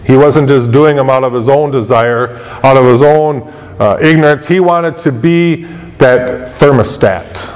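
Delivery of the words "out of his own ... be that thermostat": normal talking voice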